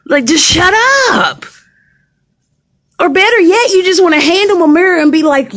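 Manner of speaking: exasperated. Real, not acted. Exasperated rant.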